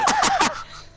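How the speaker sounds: a sudden squealing laugh; high burst of laughter. Real, not acted. Laughing high while telling a friend the story. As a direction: crack up at your own mess.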